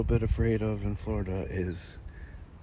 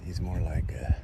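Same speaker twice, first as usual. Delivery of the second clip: whisper; slower than usual. Real, not acted. Joking quietly about the snake.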